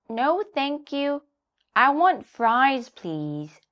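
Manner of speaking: put-on child donkey wheedling; Little Donkey. A narrator's reading. Donkey asks the hen for fries, high and slow. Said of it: "said Little Donkey".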